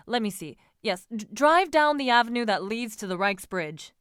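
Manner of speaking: loud, impatient orders to a coachman; calling orders. A narrator's reading. Franz telling the coachman to turn around, calling orders. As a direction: raise your voice to the driver. Man shouts orders to driver.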